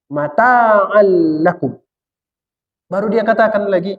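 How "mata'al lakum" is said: slow, drawn-out Arabic recitation; Quran recitation. Shown as a demonstration. Preacher reciting the verse to the congregation, slowly. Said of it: "Makanya dia sebut tadi".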